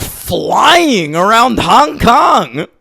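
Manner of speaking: indignant, high-pitched objection; exasperated protest. Real, not acted. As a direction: stretch the words out in high disbelief. Arguing over the scene.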